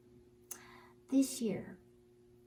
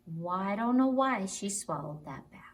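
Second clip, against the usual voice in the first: usual speaking voice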